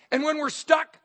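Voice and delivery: ordinary talk, nothing marked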